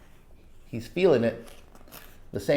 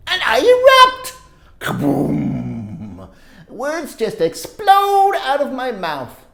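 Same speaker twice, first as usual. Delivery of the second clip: put-on lively read-aloud for kids; storybook narration; 10 semitones higher, 5 dB louder than usual. A narrator's reading. Reading a story to children, animated narration. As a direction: tell it like a picture book to children. Reads picture book aloud.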